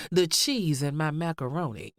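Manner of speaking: ordinary talk, nothing marked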